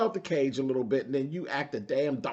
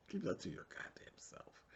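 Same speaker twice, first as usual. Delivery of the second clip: hushed, breathy scold under the breath; whispered aside; 5 semitones lower, 17 dB quieter than usual. Retold or imitated. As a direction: hiss it like a secret reprimand. Telling the guest off.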